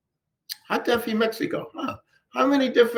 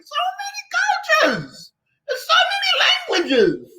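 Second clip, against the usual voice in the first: very high, drawn-out incredulous cry; emphatic exclamation; slower than usual. Real, not acted. Teacher protesting generalizations about Africa, exclaiming incredulously. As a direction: let the voice soar in exasperated amazement.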